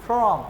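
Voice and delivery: from drawn out slowly, r silent; British from, slow. Shown as a demonstration. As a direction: say from slowly with no rolled r. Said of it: "they speak like this". (धीमे से from, आर silent)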